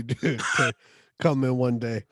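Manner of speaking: ordinary talk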